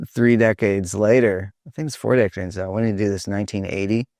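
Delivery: normal talking voice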